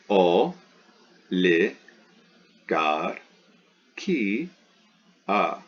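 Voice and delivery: "o, li, gar, ki, a" with a full pause after each; syllable by syllable. Shown as a demonstration. Teacher marks the syllables for students, one at a time. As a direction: break the word into isolated beats.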